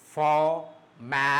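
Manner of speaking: formality in three separate beats, for, mal, ty; syllable by syllable. Shown as a demonstration. Teacher showing which part goes up, syllable by syllable. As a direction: draw formality out piece by piece. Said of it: "this part will go up".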